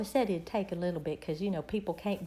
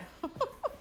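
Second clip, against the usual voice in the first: breathless giggle; 6 dB quieter than usual. Real, not acted. Laughing at contagious yawning.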